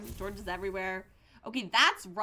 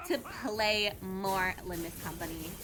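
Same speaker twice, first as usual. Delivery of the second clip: put-on valley girl. Streamer showing chat she can be extra Californian, valley girl accent. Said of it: "I can be, like, a valley girl".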